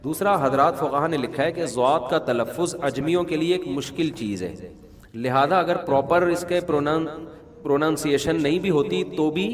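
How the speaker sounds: ordinary talk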